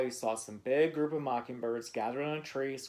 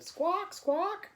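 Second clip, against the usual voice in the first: a small bird squawking. A narrator's reading. Bird squawks at the other child: high squawk. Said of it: "but she just said".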